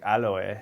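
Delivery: "Aloe" as ah-lo-eh, three syllables; Japanese-style aloe. Retold or imitated. A child at school saying "Aloe" the parents' way, ah-lo-eh. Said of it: "go to school and be like".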